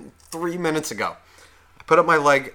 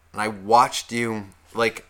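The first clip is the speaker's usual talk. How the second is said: ordinary delivery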